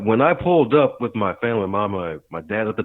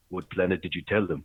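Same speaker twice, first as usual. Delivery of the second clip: put-on African accent; 3 semitones lower, 7 dB quieter than usual. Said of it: "the doc's in this African accent says".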